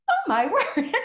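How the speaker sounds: laughing through a high, loud oh my word; amazed, breaking into laughter; laughing amazement. Retold or imitated. Retold amazed reaction.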